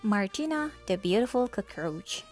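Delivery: ordinary delivery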